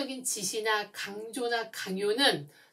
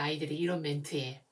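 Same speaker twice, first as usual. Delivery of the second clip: ordinary delivery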